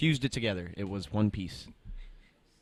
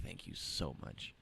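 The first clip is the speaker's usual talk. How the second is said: slow breathless thanks; grateful sigh of thanks; whispered relief; 3 semitones lower, 10 dB quieter, slower than usual. Real, not acted. Thanking a calm customer after the fight, whispered relief.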